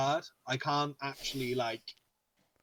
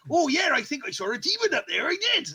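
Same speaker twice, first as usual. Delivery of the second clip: much higher, louder hammed Cornish yokel; Cornish farmer accent; 7 dB louder than usual. Gamer mimics the Cornish dub, Cornish accent. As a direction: play the dubbed Cornish villager. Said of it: "Cornish accents".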